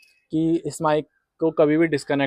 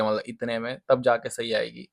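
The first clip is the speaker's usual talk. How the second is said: ordinary talk, nothing marked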